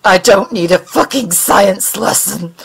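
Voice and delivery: grind it out under the breath; raspy growl. Acted. Mulder refuses the alien's lecture with a raspy growl. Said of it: "he growled in a raspy breath".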